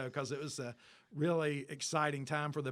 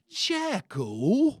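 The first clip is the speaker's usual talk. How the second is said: put-on loud, higher British-accented question, leaning in close; 9 semitones higher, 12 dB louder than usual. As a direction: echo the band name back in a British accent, amused and puzzled. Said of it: "he has that accent".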